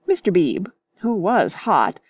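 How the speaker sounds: ordinary delivery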